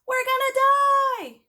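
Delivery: very high, loud, slow panicked scream. A narrator's reading. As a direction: scream it in terror. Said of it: "Michael screamed".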